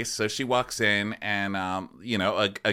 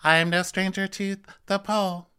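higher playful Whitney voice; mock Whitney; 10 semitones higher than usual. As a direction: tease like a cheeky Whitney. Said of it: "continuing the joke".

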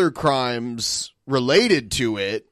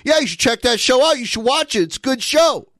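high-pitched mimicry of someone talking over her; pushy imitation, pitched way up; mock interrupting; 10 semitones higher than usual. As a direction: butt in with a high, insistent mock plug. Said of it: "interrupting her and being like".

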